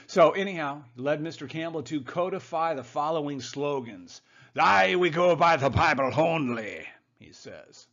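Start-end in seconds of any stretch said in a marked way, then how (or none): 4.6-6.8 hammed-up loud Scots-Irish brogue; mock Scots-Irish slogan-shouting; Scot-Irish immigrant accent. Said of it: "in his Scot-Irish, uh, immigrant accent".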